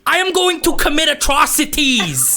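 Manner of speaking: high, deadpan threat; Bill Burr rant. Acted. Goblin vowing atrocities after losing, ranting. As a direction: promise atrocities, dead serious. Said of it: "are you channeling Bill Burr".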